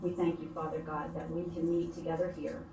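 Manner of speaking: soft, earnest praying voice; prayer. Acted. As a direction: pray quietly and sincerely. Leads opening prayer at meeting.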